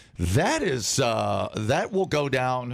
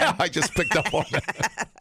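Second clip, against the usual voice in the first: laughing through the words, higher; 7 semitones higher than usual. Real, not acted. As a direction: giggle it out, amused at yourself. Laughs at own slowness.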